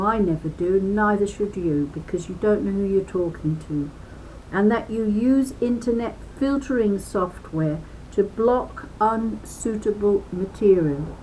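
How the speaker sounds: ordinary delivery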